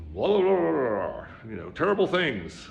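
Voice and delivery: loud, high, jeering blah-blah; hammed-up newscaster chatter; mock news babble. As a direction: parody the endless bad news.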